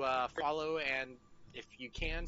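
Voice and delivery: normal talking voice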